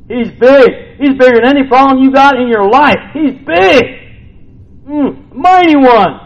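high, loud preacher's proclamation; preaching at full force. Acted. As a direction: shout out how big God is.

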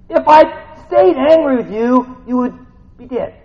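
high, loud scolding rant; hammed-up fed-up parent at full volume; exasperated God. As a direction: build to a frustrated shout for attention.

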